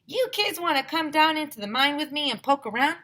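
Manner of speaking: friendly prospector invite; Mister Burke inviting kids, southern voice; southern miner invite. A narrator's reading. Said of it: "Mister Burke asked us".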